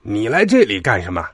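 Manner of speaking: scornful diesel engine questioning a newcomer; dismissive character voice; disdainful. A narrator's reading. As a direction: ask it with open disdain. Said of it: "迪塞尔不屑地说".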